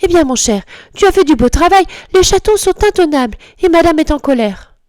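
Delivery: high, reproachful scolding; scolding duchess. A narrator's reading. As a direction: tell him off, high and prim. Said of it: "Duchesse en prend énergiquement".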